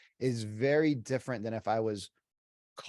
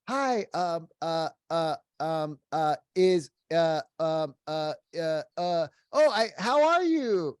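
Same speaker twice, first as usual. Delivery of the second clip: mock flustered, higher stammering voice; nervous rambling caller; 4 semitones higher, 5 dB louder, slower than usual. Agent without a script on a call: high nervous stammer. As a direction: stumble through fillers, pitched up and nervous. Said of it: "don't wanna follow a script".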